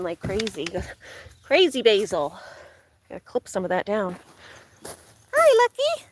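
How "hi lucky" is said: very high sing-song hello; dog greeting. Real, not acted. Greeting the dog Lucky, very high sing-song. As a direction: squeal a hello to your pet.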